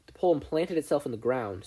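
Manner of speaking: usual speaking voice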